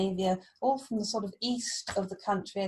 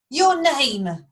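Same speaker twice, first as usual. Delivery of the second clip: loud, high demand with falling end; 6 semitones higher, 10 dB louder than usual. A narrator's reading. King demands the stranger's name, loud and commanding. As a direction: demand it sharply, voice raised. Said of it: "demanded Higelac".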